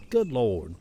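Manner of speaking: normal talking voice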